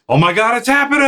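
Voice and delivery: very high comic freak-out; hammed-up cry climbing sharply in pitch; mock panic. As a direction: imitate a boss fleeing his office in terror. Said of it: "Once he runs out of his office".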